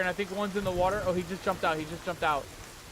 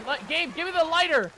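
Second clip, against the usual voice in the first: high, raised-voice demand; exasperated panic; 8 semitones higher, 4 dB louder than usual. Real, not acted. Player who can't see, demanding the lighter in panic. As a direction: demand it desperately.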